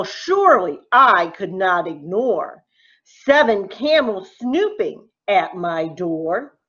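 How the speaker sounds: plain reading voice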